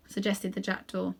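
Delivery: ordinary delivery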